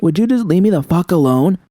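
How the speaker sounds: loud, high angry shout; shouting at V to be left alone. Acted. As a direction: snap and yell at her. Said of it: "had I really needed to shout at her like that".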